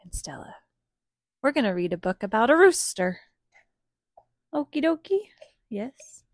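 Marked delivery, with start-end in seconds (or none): 0.0-0.5 hushed whispered echo, lower; whispering. Real, not acted. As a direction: whisper it back. Mom echoes in whisper.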